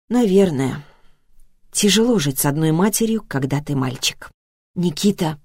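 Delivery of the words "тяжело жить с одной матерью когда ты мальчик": ordinary delivery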